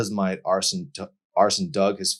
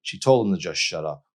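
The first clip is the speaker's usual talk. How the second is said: usual speaking voice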